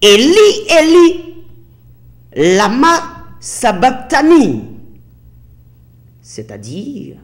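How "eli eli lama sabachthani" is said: loud, high cry with drawn-out words; Jesus crying out, full voice. Retold or imitated. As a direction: cry it out in a strong, raised voice. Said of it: "d'une voix forte".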